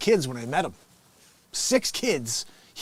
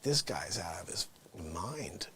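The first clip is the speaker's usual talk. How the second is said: unvoiced whisper; whispered thought; 11 semitones lower, 14 dB quieter, slower than usual. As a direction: whisper it to yourself in disbelief. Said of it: "And I was like".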